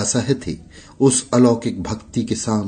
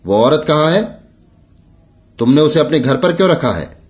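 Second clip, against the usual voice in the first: higher-pitched, mildly angry questioning; scolding; 5 semitones higher, 4 dB louder, faster than usual. A narrator's reading. Sahib scolds Mangru from afar about the woman, scolding. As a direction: demand answers in a raised, scolding pitch. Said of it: "साहब ने दूर से ही डांटा".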